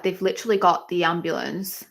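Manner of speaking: ordinary delivery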